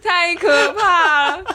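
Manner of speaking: slow, high, laughing horror; mock horror. Real, not acted. Reacts to gruesome explanation.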